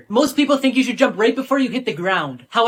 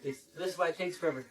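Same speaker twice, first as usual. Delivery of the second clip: ordinary talk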